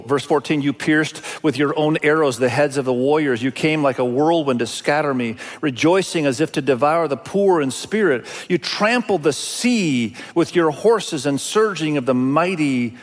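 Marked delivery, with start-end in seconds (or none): none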